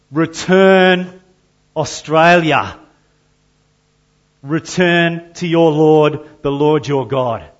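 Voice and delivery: high, loud divine summons; a booming plea to return; God's call. Acted. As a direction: call the nation home with a loud cry. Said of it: "He's saying".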